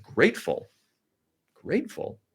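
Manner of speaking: imitated child's baffled questioning; puzzled little daughter. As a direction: echo it like a confused four-year-old. Retelling daughter puzzled by grateful.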